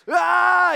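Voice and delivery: loud, high startled scream; mock scream. Naked guys charging, a loud mock scream. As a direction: let out a hammed-up yell of alarm. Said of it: "and they're like".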